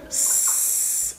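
"س" as a long voiceless sss; isolated S sound. Shown as a demonstration. Teacher shows how C is pronounced before three letters, "س" as a long sss. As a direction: hold "س" as a pure hiss with no vowel. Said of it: "بتنطق في الحالة التلاتة دول بس كده".

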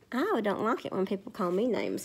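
plain reading voice

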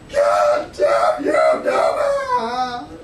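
loud, slow, anguished cry of defeat; despairing wail. Retold or imitated. Drill sergeant learns dad broke the door, loud anguished wail. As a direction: crumble as you cry it out. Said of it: "The guy just fell to pieces".

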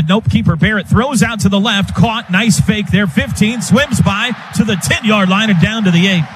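excited play-by-play. Real, not acted. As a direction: sell the big play as it happens. Live call of big play.

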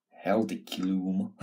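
"Hell de Clume" said slowly, each syllable fumbled out of place; botched name. Real, not acted. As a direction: stumble slowly over "Hell de Clume" as a wrong guess at the name. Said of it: "That was the worst pronunci".

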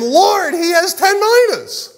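very high, drawn-out protest; high-pitched, slow acted yell; crowd's protesting outcry. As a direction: cry out like an indignant listener in the crowd. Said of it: "they yell out".

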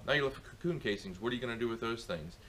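ordinary talk

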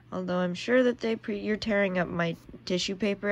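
ordinary delivery

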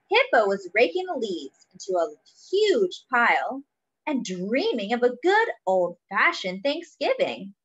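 ordinary delivery

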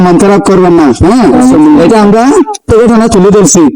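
ordinary talk, nothing marked

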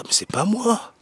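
mock protest. Imagining the owner seeing the video online, mock protest. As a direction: play the owner protesting his innocence, voice up. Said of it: "il va dire".

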